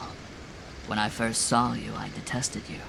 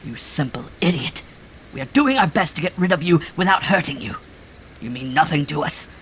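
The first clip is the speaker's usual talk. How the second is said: loud, much higher, ranting tirade; furious shouting rant; 6 semitones higher, 7 dB louder than usual. A narrator's reading. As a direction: hurl it as a raving sermon of contempt. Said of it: "he shouted".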